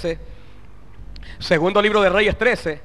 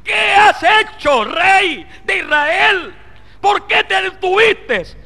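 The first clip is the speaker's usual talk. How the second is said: high, slow, booming rebuke; angry prophet; 11 semitones higher, much slower than usual. Retold or imitated. Prophet Eliseo rebuking the king, slow furious shout. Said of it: "vino el profeta enojado y le dijo".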